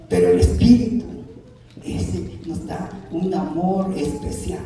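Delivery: rising and falling pulpit cadence, pressing each point; a Spanish-language pastor preaching with conviction; fervent preaching. Acted. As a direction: preach it out to the congregation like a revival. Preaching to the congregation.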